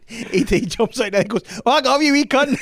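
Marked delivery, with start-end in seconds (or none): 1.7-2.6 put-on loud, high Scottish accent. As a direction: shout it high as a Scottish alien. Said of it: "What If E T Was Scottish".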